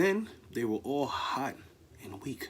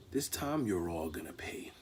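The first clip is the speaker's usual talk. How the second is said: whispered threat; menacing whisper; 7 semitones lower, 7 dB quieter than usual. Acted. Jaguar whispering a threat to the trapped animals. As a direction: hiss the threat softly.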